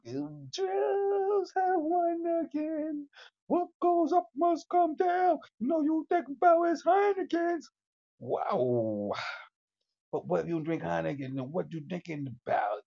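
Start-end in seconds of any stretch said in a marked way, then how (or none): 0.1-7.7 high ranting. Acted. Conspiracy rant, high.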